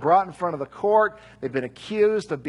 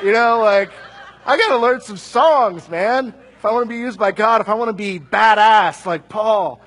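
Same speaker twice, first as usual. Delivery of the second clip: laughing, louder and higher as the joke lands; 3 semitones higher, 8 dB louder than usual. Real, not acted. Preacher laughing at his own Kumbaya joke, louder and higher. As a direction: chuckle through it, amused at yourself.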